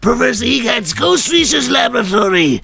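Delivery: put-on high, slow old professor voice; old man voice. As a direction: ham up a quirky old scientist. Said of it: "old man voice".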